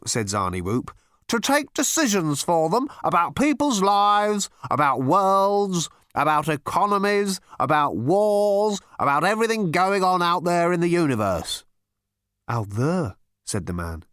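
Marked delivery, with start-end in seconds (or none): 1.3-11.6 high, mounting list of grievances, stretching key words; pompous, frustrated insistence; exasperated interrogator. A narrator's reading. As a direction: hammer out each item at raised pitch.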